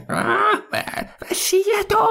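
put-on old man calling back from a distance; very high, thin distant call; far-off voice. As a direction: answer from far across the maze. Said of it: "Så kunne han høre i det fjerne".

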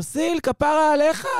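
high, drawn-out mock yell; imitated shout. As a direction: call it out slowly, high and loud, imitating her. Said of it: "וצועקת לו".

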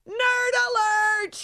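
high, slow mock alarm call; nerd alert voice. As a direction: sing it out like a siren. Mock alarm after nerd stat.

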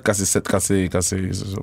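fading into silence mid-sentence; trailing off. Real, not acted. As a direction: let the phrase die out. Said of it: "C'est le fun quand tu finis tes phrases".

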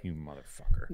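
low, drawn-back cursing under the breath; muttered curse. Retold or imitated. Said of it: "I was just like".

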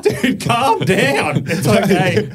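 slow, high and laughing; laughing to settle a wild mate; laughing, calming him down. Retold or imitated. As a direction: soothe him through laughter. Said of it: "I'm like".

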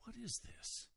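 high, soft, unvoiced gasp of wonder; hushed, high-pitched astonishment; amazed. Onlookers saying to themselves, utterly amazed. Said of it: "utterly amazed".

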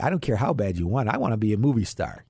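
usual speaking voice

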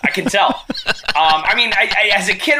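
chuckling through the words; laughing. Real, not acted. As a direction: laugh while answering. Laughing at the bad segue.

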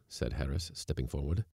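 ordinary delivery

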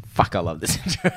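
low, soft muttered aside; hushed inner thought. Retold or imitated. Sitting silently during the intro, a hushed inner thought. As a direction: think it to yourself, quietly. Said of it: "and I'm like".

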